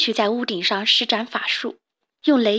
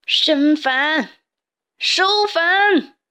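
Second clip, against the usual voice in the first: much higher, louder captain's command; frantic captain shouting; shouted orders; 6 semitones higher, 4 dB louder, much slower than usual. Acted. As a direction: yell orders across the deck. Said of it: "对着大伙大喊".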